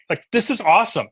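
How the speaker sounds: gushing, pitch jumping up; excited fanboying. Real, not acted. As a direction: rave about the gear like a fan. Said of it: "I'm done fanboying".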